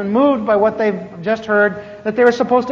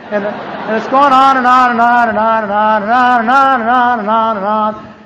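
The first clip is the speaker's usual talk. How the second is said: stretched repeating on and on, a bit louder; droning on and on; 4 dB louder than usual. Retold or imitated. Complaining the sermon never ends, droning on and on. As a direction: hammer the endless and on and on.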